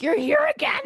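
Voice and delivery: high-pitched retold exclamation of dismay; squeaky-high, hammed-up alarm; mock dismay. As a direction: exclaim it as if caught out. Retold dismay at seeing her return.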